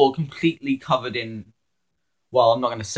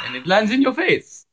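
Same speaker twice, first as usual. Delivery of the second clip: show tune quote; 7 semitones higher than usual. Retold or imitated. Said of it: "a line from Fiddler on the Roof".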